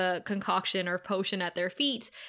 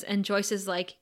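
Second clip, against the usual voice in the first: ordinary delivery, nothing marked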